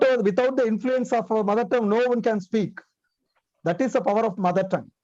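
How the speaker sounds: ordinary delivery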